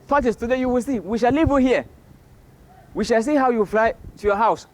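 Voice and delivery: high-pitched, scolding warning; birds' mock threat. Birds vow to leave Tortoise in tree, high scolding threat.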